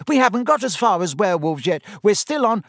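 quick indignant schoolgirl; hurried protest. A narrator's reading. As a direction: rush the protest. Parvati voiced.

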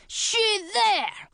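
high-pitched troll roar. Acted. Troll spotting the two hidden runaways, roaring. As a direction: roar it out as an alarm. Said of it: "the troll roared".

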